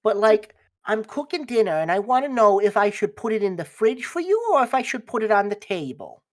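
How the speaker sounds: high, loud motherly chirp; dearie-calling mother. Acted. Mother phoning her child about coming home, high doting voice. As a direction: sing out brightly as a doting mom. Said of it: "your mother's on the other end".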